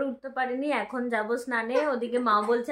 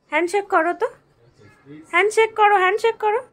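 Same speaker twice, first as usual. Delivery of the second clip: high, loud sing-song coaxing; cheerfully urging the little one to shake hands; 6 semitones higher, 8 dB louder than usual. Real, not acted. Mother coaxing her little child to shake hands, high sing-song baby talk.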